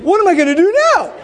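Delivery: loud, high, fast panicked cry; hammed-up frantic wail; mock panic. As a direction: blurt it out in comic desperation. Retold panic over burning engine.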